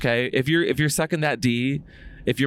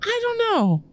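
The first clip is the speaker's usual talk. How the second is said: much higher, strained voice; high-pitched; slower than usual. Real, not acted. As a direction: pitch way up while answering. Answering the future question.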